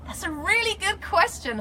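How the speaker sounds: high, loud and impressed; delighted reaction. Real, not acted. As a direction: pitch it way up, relishing the question. Delighted by the fan's question.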